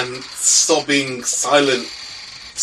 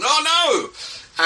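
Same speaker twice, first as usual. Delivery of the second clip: high, sudden "Oh no!"; alarmed cry; 13 semitones higher than usual. Acted. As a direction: gasp it out in alarm. GM voices player's alarmed character.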